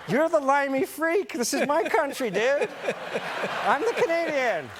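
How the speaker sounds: high, protesting comeback to dad; exasperated son, acted for laughs; indignant retort.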